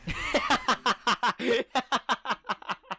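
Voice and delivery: high, loud helpless cackle; can't hold the laugh in; burst of laughter. Real, not acted. Viewer cracking up at a painting, loud laugh. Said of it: "this one makes me laugh".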